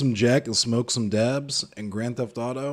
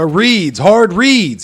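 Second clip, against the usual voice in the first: loud, hyped, higher yell; excited callout; 9 semitones higher, 13 dB louder, much slower than usual. Real, not acted. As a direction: shout it out, fired up. Hype calling out opponent reads.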